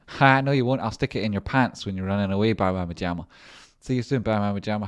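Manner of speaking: loud playful teasing; loud mock taunt to a friend; mock threat. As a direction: bark it out like a jokey threat.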